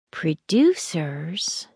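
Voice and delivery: slow, awed drawing out of "Producers"; a child impressed, saying "Producers" slowly; truly impressed. A narrator's reading. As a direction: savour the word "Producers" in wonder. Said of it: "truly impressed".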